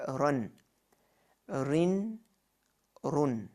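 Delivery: "run" with short u, "rin" with short i, then "run" again; Arabic tanwin sounds. Shown as a demonstration. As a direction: say "run", "rin", "run" clearly, each syllable on its own. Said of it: "So we say".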